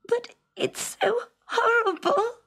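high, crying complaint; tearful princess. A narrator's reading. Princess crying to her father about the frog. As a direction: whimper it through tears. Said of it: "she muttered through her tears".

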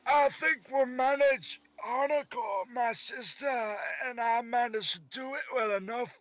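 put-on high Scottish girl's voice; Scottish accent. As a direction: keep the Scottish brogue going. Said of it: "Scottish accent".

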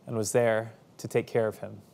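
usual speaking voice

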